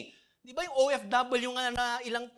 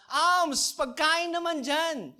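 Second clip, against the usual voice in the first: louder, higher beggar's call from afar; a loud, raised beggar's cry for food; 7 semitones higher, 8 dB louder, much slower than usual. Retold or imitated. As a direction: call across the distance for alms. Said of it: "Isisigaw nila kasi medyo malayo eh".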